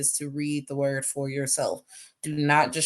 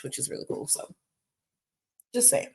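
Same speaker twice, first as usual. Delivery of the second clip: whispered aside; 2 semitones lower, 7 dB quieter than usual. Real, not acted.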